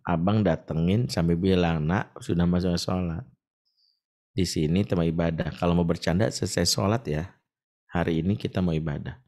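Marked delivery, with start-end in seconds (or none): 1.8-9.2 put-on soft, low coaxing words to children; gentle demo. Shown as a demonstration. Preacher shows how to calmly address children, softly. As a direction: model a kind reminder.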